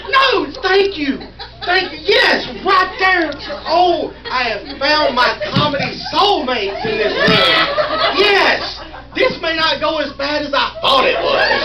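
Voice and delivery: loud, overjoyed relief; elated gratitude. Real, not acted. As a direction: cry out as if you've found your people. Thanks crowd for getting his joke.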